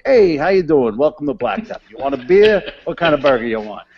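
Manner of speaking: loud, high, brash barman welcome; a boisterous Tony and Joe show pitch; hammed-up bartender greeting. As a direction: greet the bar crowd like a pushy New York bartender. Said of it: "It was like the Tony and Joe show every day".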